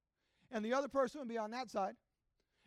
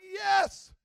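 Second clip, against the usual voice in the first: loud, raised triumphant yes; cheering mother; 6 semitones higher, 9 dB louder than usual. Retold or imitated. Mother after her son finally tackles someone, cheering. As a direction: imitate a mother cheering a breakthrough. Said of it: "She'd be like".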